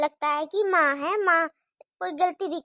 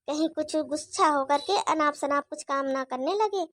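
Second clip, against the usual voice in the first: usual speaking voice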